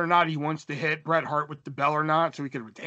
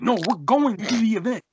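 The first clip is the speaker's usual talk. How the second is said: emphatic, louder, higher protest; insistent; 6 semitones higher, 5 dB louder than usual. Retold or imitated. Teen insisting to mom and her boyfriend, insistent. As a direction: stress GOING, pushing back.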